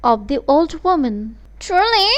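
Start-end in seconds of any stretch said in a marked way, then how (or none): 1.6-2.1 high, excited "Truly?"; mother thrilled at the thought of riches; eager cry. Acted. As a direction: leap on the news. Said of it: "cried the mother".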